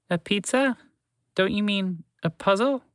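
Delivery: high squeaky mouse, confused rising questions; puzzled mouse. Acted. Mouse questioning the horse's pizza talk, high and baffled. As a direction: correct the odd word, baffled.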